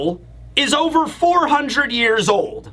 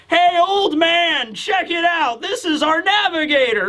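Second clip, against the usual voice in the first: retold boisterous hail; 7 semitones higher than usual. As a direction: hail him like a rowdy buddy, pitched way up. Retelling Usopp's drunken banter.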